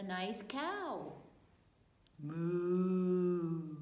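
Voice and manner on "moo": cow moo. Acted. Storyteller voicing the cow.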